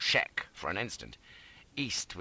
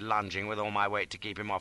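ordinary delivery

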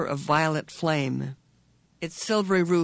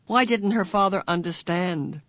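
ordinary delivery

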